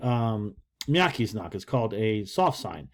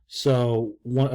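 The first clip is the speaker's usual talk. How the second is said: ordinary delivery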